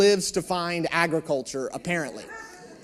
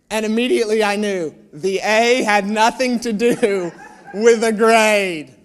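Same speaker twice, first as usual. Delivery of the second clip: laughing realization; 6 dB louder than usual. Real, not acted. Realizing the A meant agriculture.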